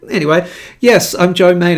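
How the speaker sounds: ordinary talk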